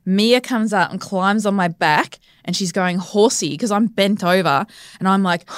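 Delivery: usual speaking voice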